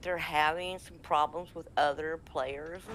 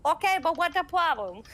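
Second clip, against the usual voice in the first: high, loud mock-accent character; put-on character voice; 10 semitones higher, 7 dB louder than usual. Character answers gushing fan, high loud put-on accent. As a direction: cut in with a loud, pitched-up accented voice. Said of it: "And I'm like".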